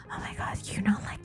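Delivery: hushed gossip, breathy and secret; gossipy whisper. Retold or imitated. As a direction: lean in and whisper the rumour. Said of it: "There are whispers about".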